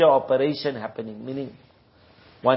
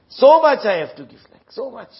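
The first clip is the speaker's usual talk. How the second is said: shocked rich man; 8 semitones higher, 5 dB louder than usual. Retold or imitated.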